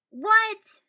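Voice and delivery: very high, loud yelp of disbelief; outraged exclamation. A narrator's reading. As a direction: burst out in outrage. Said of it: "Naruto exclaimed, outraged".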